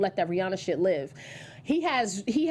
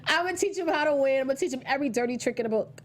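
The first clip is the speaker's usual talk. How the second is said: strained, higher-pitched voice from being unwell; under-the-weather voice; 5 semitones higher, faster than usual. Real, not acted. Host quoting a boxer's promise while unwell, strained voice. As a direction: push out a quote with a sick, strained voice. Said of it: "Excuse my voice, guys. I'm a little under the weather".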